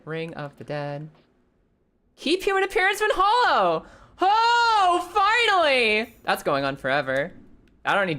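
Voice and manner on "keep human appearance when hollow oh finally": excited. Real, not acted. Finally finds the wanted ring.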